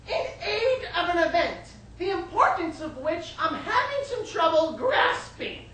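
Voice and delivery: high, slow, drawn-out scorn; slow, elevated theatrical disdain; disdainful mother. Acted. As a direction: stretch it out with a mother's haughty sarcasm. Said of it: "she said".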